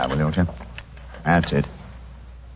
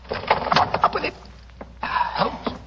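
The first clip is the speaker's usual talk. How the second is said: whisper. Acted. Sneaking in the dark museum.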